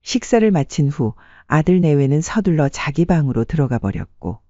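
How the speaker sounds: ordinary delivery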